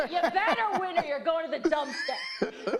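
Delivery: half-joking New Jersey tough talk, hammed up; blunt Jersey way. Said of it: "this is how we say it up here in Jersey".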